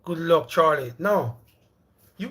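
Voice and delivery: ordinary talk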